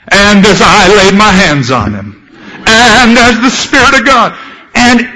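hammed-up faith healer rising to a climax; mock bragging healer. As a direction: puff it up like a self-glorying healer. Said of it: "braggadocious preacher".

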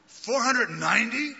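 high, loud startled answer; surprised total. Retold or imitated. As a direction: blurt the sum out amazed. Peter reaching the total.

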